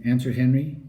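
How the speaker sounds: ordinary delivery, nothing marked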